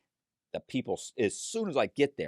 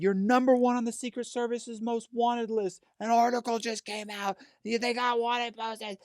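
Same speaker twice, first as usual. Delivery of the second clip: high, a bit louder breathless warning; alarmed Becky; 14 semitones higher, 6 dB louder than usual. Retold or imitated. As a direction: blurt out the bad news in a high voice. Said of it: "she said".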